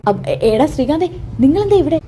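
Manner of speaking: stammering surprise. Acted. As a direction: fumble the words, then blurt it out. Sachi stammers, confused at station.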